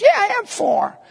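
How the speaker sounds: put-on high, small child's voice; high little-boy voice, lightly done; little son's reply. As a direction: answer like a proud four-year-old.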